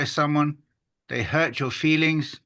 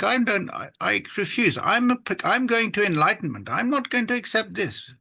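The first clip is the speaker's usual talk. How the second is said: raised, indignant inner protest; 7 semitones higher than usual. Retold or imitated. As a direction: play the offended seeker refusing to accept it. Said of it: "And we think".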